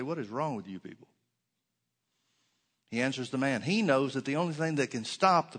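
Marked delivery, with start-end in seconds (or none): 0.0-1.1 muttered mock rebuke, lower and softer; a hint of an exasperated teacher; imagined scolding. Jesus pictured scolding the disciples, muttered rebuke. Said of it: "doesn't turn around ... and say".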